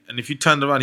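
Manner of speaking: ordinary talk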